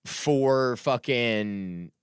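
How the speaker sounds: ordinary talk, nothing marked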